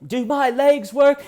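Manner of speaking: very high, loud mock outburst; hammed-up indignant cry in a silly accent; Nicholas Alaverdian impression. Suspect challenges journalists from wheelchair, hammed-up outburst. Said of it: "And he goes".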